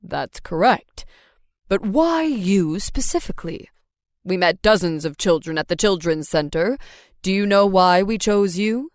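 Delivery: a patient father questioning; dad voice. A narrator's reading.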